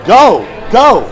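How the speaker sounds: urging. Real, not acted. Urging listeners to theaters.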